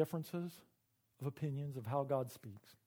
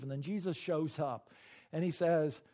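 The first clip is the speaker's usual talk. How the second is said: ordinary talk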